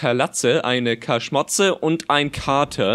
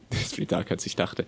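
hushed whisper at the gloom; awed whisper; 6 semitones lower, 9 dB quieter, much faster than usual. Real, not acted. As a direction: breathe it out unvoiced. Sudden dark game scene.

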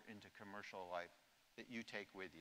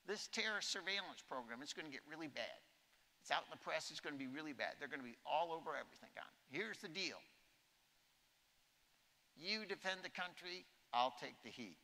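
higher, earnest president voice; imitating the president; 6 semitones higher than usual. President tells the general to defend the country, higher and earnest. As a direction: lay out the deal like a resolute leader. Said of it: "And he goes".

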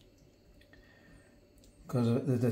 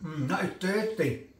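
talking through a mouthful of curry; words round a bite of food; mouth full; 7 dB quieter than usual. Real, not acted. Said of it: "talking with my mouth full".

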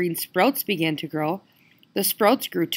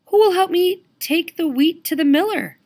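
high, louder hen voice; little red hen voice; 11 semitones higher, 5 dB louder than usual. Acted. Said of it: "she asked".

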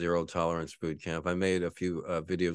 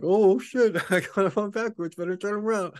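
startled panic; 5 dB louder than usual. Retold or imitated. Panicked newbie realizing.

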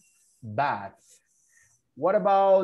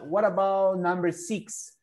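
ordinary talk

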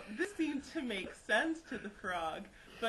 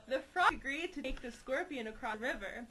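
ordinary delivery, nothing marked

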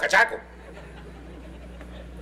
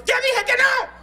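put-on whiny child; slower than usual. Spoiled child refusing.

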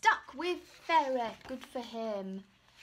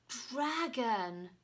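ordinary delivery, nothing marked

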